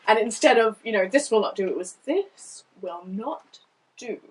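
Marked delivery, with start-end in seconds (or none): 2.0-4.2 slow, quiet, drawn-out reading; slow owl voice. Shown as a demonstration. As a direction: read it slowly like a long thinker. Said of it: "the words slowed down because I was reading the owl".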